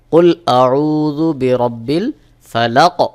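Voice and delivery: "ফালাক" ending bounced with a qalqalah echo; qalqalah demo. Shown as a demonstration. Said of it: "যেমন". ("ফালাক" শেষে কলকলাহ প্রতিধ্বনি)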